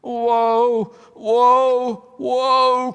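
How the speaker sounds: high, loud, eerie wailing woe; wailing call. A narrator's reading. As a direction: call out like a grieving tree spirit. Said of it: "called the voice".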